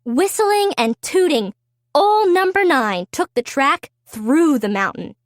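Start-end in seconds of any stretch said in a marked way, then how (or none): none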